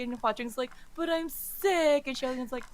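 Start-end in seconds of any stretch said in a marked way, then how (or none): 0.9-2.1 high, drawn-out bratty protest; whining. Acted. Patient refusing calligraphy practice, whining. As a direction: drag it out in a childish whine. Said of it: "he's, like, whining".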